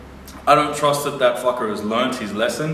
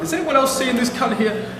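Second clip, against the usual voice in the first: much higher, calling out to the carriage; retold call-out; 13 semitones higher than usual. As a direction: replay rallying the passengers. Calling out to other passengers.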